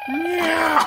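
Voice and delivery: high, long meow; yowling cat meow. Pretend. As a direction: let out a drawn-out kitty yowl. Playing the kitty.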